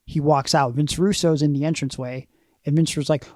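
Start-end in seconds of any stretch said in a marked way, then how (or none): none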